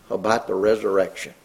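usual speaking voice

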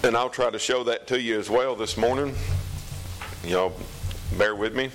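usual speaking voice

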